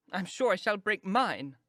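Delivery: put-on slow, high, pouting sigh of a girl; sulky girl. A narrator's reading. Lydia pining to her mother, slow high pout. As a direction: drag it out like a heartbroken girl.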